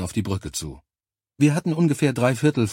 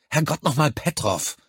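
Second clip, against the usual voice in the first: higher-pitched burst of swearing, dropping on the name; exasperated cursing; 6 semitones higher than usual. A narrator's reading. Soldier swearing at teammate who dropped the carrier, exasperated. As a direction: curse at a clumsy comrade. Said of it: "fluchte Rogers los".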